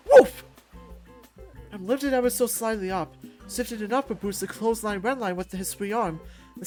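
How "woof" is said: loud, high "woof" of impact; winded impact yelp. Acted. Fighter hit by a clothesline, sharp winded yelp. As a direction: yelp as the air is knocked out.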